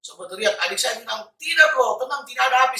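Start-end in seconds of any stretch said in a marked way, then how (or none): 1.4-2.8 high, loud rebuttal; raised voice, insistently contradicting; retold shouted denial. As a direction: shout back across the house. Sister shouting back in retold argument.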